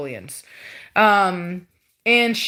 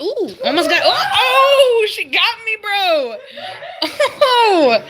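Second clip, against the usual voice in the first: high laughing exclamations, louder; laughing yelp; 11 semitones higher, 5 dB louder, slower than usual. Real, not acted. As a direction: laugh it off after getting bitten. Bitten by puppy, laughing.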